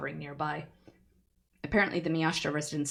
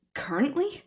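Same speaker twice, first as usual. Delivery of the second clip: stunned echo; 10 semitones higher, 4 dB louder than usual. Acted.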